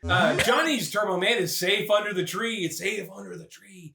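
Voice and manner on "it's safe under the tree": soft, slow, lower aside; quiet, drawn-out reassurance; hushed repeat. Acted. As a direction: say it slowly, almost under your breath.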